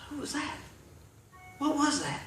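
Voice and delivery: high, very soft startled questions; startled, barely daring to ask; hushed alarm. Retold or imitated. Man hearing a voice in an empty room, hushed alarm.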